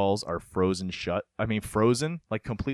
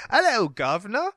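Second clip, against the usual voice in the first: higher, louder mock Cockney greeting; Cockney accent; 9 semitones higher, 5 dB louder than usual. Jokingly showing the Cockney accent he avoided. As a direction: tip your cap, hammed-up Cockney. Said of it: "give 'em a British".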